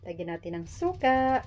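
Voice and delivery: ordinary talk, nothing marked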